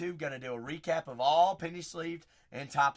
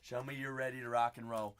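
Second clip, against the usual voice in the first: ordinary talk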